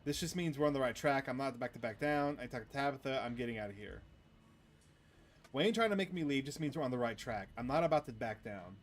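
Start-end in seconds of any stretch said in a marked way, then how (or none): none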